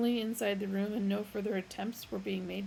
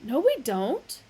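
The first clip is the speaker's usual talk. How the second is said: loud, higher; irritated; 5 semitones higher, 9 dB louder than usual. A narrator's reading. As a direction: snap it back. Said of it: "exclaimed in irritation".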